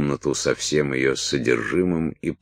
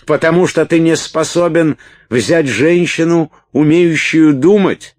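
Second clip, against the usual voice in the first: high tirade building from raised voice to full shout; escalating accusation; 13 semitones higher, 8 dB louder than usual. A narrator's reading. Салли attacks Уилт's manhood, angry building shouting. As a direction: hurl each question louder, up to a scream. Салли обвиняет, доходя до крика.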